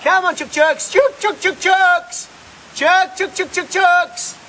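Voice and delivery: high sing-song call to the hens; calling chickens. Real, not acted. Calling his chickens to come.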